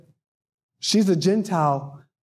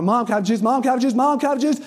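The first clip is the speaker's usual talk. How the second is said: climbing higher with each repeat; pestering child; 4 semitones higher, much faster than usual. Retold or imitated. Child interrupting his parents to ask for juice, pestering. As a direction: nag like a kid who won't be ignored. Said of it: "he begins to just talk, talk".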